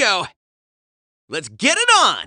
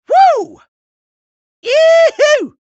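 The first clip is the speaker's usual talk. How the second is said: loud whoops, pitched way up; high whooping cheer; 7 dB louder, much slower than usual. Acted. Winning racer whooping loud and high. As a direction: whoop it up like a cowboy.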